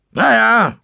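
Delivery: high, loud, coarse sailor voice; rough old seaman. Acted. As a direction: size up the tavern like a rough sea dog. Seaman answers the innkeeper.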